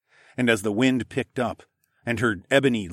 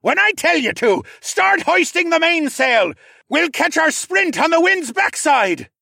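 high, loud captain's orders; full-force commanding shout; 8 dB louder than usual. A narrator's reading. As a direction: bellow instructions over a hurricane.

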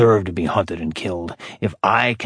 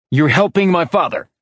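higher and louder, hurled at him in fury; furious accusation; 7 semitones higher, 3 dB louder than usual. A narrator's reading. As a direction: spit the accusation at the boss. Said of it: "Furious, he glared at his boss".

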